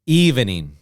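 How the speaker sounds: evening in three clear syllables, EV-E-NING. Shown as a demonstration. Teacher models the full form for the class. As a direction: spell out all three beats of evening. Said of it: "three sounds".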